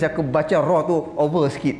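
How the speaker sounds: ordinary talk, nothing marked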